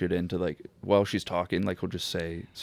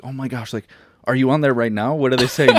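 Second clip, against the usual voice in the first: rising, louder, excited questions; eager old lady; 5 semitones higher, 9 dB louder than usual. Retold or imitated. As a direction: play the nosy coworker, getting louder and higher. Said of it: "and is like".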